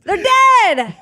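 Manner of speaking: high, loud, drawn-out comic yell; mock-menacing yell. Guest joking about the absent fourth person, mock yell. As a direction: yell it with gleeful overkill.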